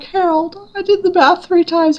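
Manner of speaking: high, protesting self-quote; mock-offended squeak; indignant protest. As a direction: insist it's not your fault. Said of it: "I'm like".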